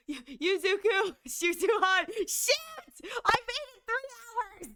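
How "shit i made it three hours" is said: mock wailing. Real, not acted. Broke streak, mock wailing.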